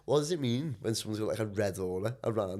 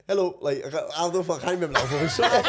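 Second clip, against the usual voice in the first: high, loud laughing Indian accent put-on; a giggling attempt at an Indian accent; mock Indian accent; 8 semitones higher, 9 dB louder, faster than usual. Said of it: "did you just do an Indian accent".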